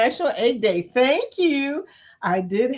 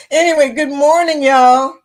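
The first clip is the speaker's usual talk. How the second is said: high, loud, cheery hello; belted morning greeting; 5 semitones higher, 10 dB louder than usual. Real, not acted. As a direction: call out good morning to the whole crowd. Opening greeting called to viewers.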